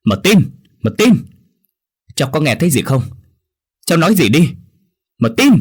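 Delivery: frantic high, loud shout calling Martin. A narrator's reading. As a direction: yell desperately for the boy. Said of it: "Tôi thét lớn". (hét lớn hoảng loạn gọi Martin)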